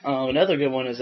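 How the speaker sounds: ordinary delivery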